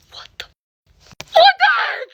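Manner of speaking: high, loud shriek of disbelief; shocked yell. Retold or imitated. Teller reliving shock for viewers, shocked yell. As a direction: cry out aghast.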